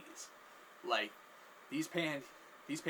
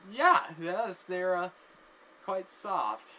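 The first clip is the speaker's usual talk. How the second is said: higher, louder voice; flustered acted answer; 6 semitones higher, 9 dB louder, much slower than usual. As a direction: answer awkwardly, pitched up and loud. Flustered answer about the panties.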